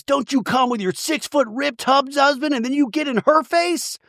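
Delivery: high-pitched mock yell; imitating a screaming argument; retold screaming. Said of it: "Emily's screaming in her face".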